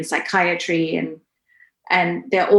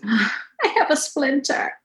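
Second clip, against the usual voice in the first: put-on child's voice; 7 semitones higher, slower than usual. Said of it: "she says".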